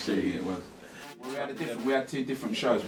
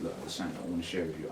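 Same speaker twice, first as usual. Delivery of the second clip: soft and intimate, quickly; hushed and personal, not shouted; 3 semitones lower, 7 dB quieter, faster than usual. Shown as a demonstration. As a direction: say it close, like to one person. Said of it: "I wouldn't shout it".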